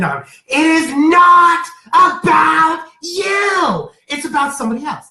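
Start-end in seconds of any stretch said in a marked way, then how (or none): 0.5-3.9 emphatic. Real, not acted. Reader stresses a point.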